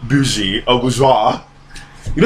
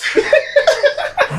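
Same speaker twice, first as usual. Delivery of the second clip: high squeal of laughter; cackling at her friend's jab; shrieking laugh. Real, not acted. Mock outrage at the travel line.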